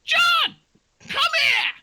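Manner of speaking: very high, loud call across the field; high doe shouting; squeaky doe yelling. Acted. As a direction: shout for John in a cartoon doe voice. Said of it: "she's shouting over to".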